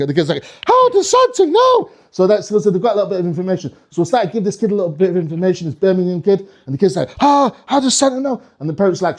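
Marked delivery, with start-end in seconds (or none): 0.7-1.9 put-on very high, stretched kid voice; amazed child. Kid stunned by what Santa knows, amazed. 7.2-8.4 put-on high-pitched gasping kid voice; amazed child. Birmingham kid stunned by Santa's inside information, amazed.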